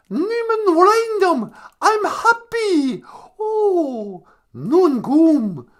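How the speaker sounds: high, slow, loud mock declaiming; Greta Thunberg. Activist repeats a new Ojibwe phrase, mock high wailing. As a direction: draw the words out in a high, hammed-up wail. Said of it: "can you say Niman Wayndam, uh, Greta Thunberg".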